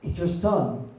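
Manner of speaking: a touch of an urgent mother; mock whispering mother. Wife telling husband who is calling, mock whispering mother. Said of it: "I heard a lot of whispering".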